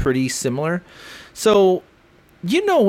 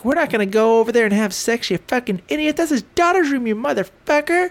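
much higher mock-outraged wife; 9 semitones higher than usual. Wife telling Corey off, high mock voice. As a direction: squeak out the wife's scolding in a high put-on voice. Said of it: "she's like".

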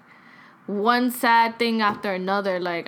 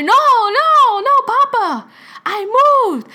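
loud, high re-enacted phone yell; insistent imitated shout into the phone; retold screaming correction; 13 semitones higher, 9 dB louder, slower than usual. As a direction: yell it for someone hard of hearing. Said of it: "I'm screaming on the phone".

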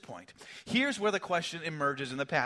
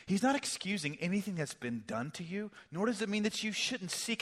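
ordinary talk, nothing marked

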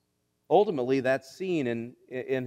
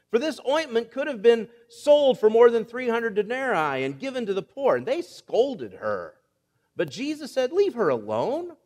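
scripture reading; 5 semitones higher than usual. Acted.

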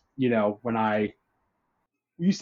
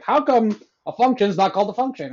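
mocking Redditor; 8 semitones higher, 3 dB louder than usual.

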